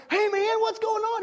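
high, bright mock greeting; high cheery hello; playful greeting. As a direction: imitate a happy kid's hello. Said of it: "it's like you went up and be like".

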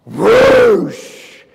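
imitated burst of fizz; loud mimicked whoosh; loud whoosh sound. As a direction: blast it out like a spray.

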